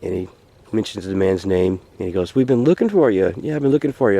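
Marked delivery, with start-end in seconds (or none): 2.4-4.2 high, eager greeting; storyteller voicing a glad, friendly greeter; welcoming stranger. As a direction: greet someone you've been searching for.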